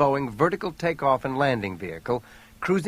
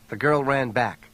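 ordinary delivery

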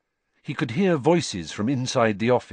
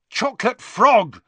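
high, loud furious shout; angry yell; 14 semitones higher, 7 dB louder than usual. A narrator's reading. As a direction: yell it while hopping in pain. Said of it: "he yelled angrily".

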